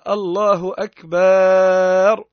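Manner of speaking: "الله أكبر" drawn out slowly and loud, ending on a rise; slow drawn-out "الله أكبر" with the vowel before the final "ر" stretched; stretched takbir. Shown as a demonstration. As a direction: sing out "أكبر" long like a call to prayer. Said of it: "يحط ألف بينها وبين الراء".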